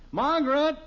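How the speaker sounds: loud high call across the house. Acted. Father calls wife from the den, loud call. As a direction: call her from the other room.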